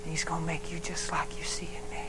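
soft tender whisper. Acted. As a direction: whisper it like a promise to a child.

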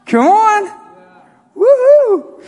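very high, loud cheer; real excited whoop. Real, not acted. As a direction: whoop it up for the congregation. Preacher whooping after his point.

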